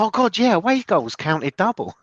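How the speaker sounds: high-pitched mock realisation; acted sudden recollection. Podcaster voicing a reader recalling the old rule, acted surprise. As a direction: exclaim it as if just remembering, pitch jumping up.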